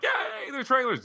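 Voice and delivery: high, drawn-out mock cheer; gleeful cheer. Guest acting out himself as trailers start in the theater, gleeful. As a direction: stretch the yay in mock glee.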